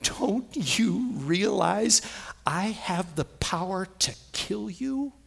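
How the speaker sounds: slow, drawn-out menacing question; voice of pain. Acted. Trouble speaking to the believer, slow restrained threat. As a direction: threaten slowly, without raising the volume. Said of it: "the voice that says to you".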